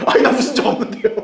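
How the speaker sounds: high, hammed-up protest breaking into laughter; mock innocent denial. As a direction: act caught out and denying it. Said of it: "그럼 그때 우리가 뭐라 그랬어요".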